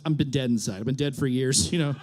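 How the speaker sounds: normal talking voice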